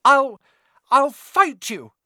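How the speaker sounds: high, halting, scared but brave; a timid boy forcing himself to stand firm; nervous defiance. A narrator's reading. Neville facing down his friends, voice shaking with nerves.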